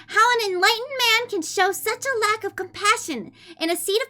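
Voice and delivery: put-on high, sweet anime-girl reading voice; fake cute voice. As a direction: read it cutesy and high-pitched. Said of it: "I'll read the first page in a kawaii voice".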